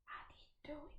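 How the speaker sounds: breathy confessional whisper, high and hushed; mock guilty whisper. Gardener confessing to viewers, in a mock guilty whisper.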